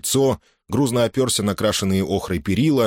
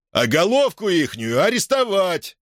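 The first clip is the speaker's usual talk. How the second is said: plain reading voice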